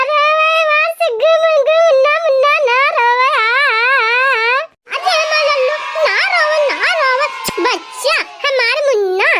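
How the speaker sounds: high, cooing hush to a newborn; hushing baby. Acted. Grandmother hushes the crying newborn, cooing tenderly.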